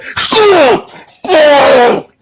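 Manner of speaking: high, loud drawn-out wail; mock cry. Mimicking what keeps popping up.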